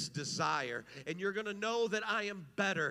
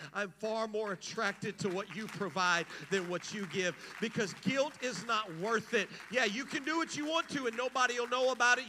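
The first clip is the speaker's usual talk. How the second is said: louder, faster preaching push; full-force preaching; 5 semitones higher, 4 dB louder than usual. Acted. Preacher urging the congregation about hidden sin, fast and loud. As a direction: drive it home to the congregation at speed.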